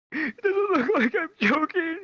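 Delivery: high, slow strained squeal; pained retort. Retold or imitated. Hurt kid answering his teacher, high strained whine.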